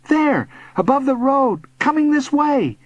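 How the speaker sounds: high, urgent directions; excited pointing out. A narrator's reading. Boy showing bully the flyer, excited. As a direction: show them exactly where, excitedly.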